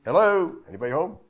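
high, loud call of Hello. Shown as a demonstration. Visitor calling out at the gate, loud call. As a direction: call toward a house from the gate. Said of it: "that's where you would say".

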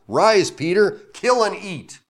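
high, loud commanding call; voice of God. Acted. God calling down to hungry Peter, commanding. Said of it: "And there came a voice".

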